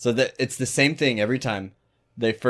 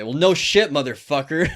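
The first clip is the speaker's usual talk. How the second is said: louder, higher mocking jab; breaking character; 5 semitones higher, 6 dB louder than usual. Prankster dropping the act on the caller, mock loud. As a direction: drop the act and snap back. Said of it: "that's when I break character".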